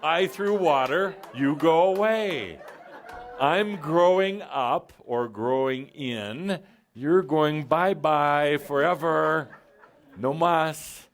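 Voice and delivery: gleeful, slowed banishing with laughter; mock triumphant. Speaker dismissing the emotional self like a melted witch, gleeful and loud.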